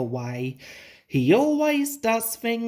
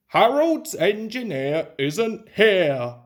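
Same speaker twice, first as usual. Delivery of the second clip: booming; 3 dB louder than usual. A narrator's reading. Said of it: "he boomed".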